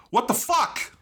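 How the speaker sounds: incredulous outrage. Real, not acted. Host outraged the fairy gets no mech, incredulous. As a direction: throw up your hands at the writers.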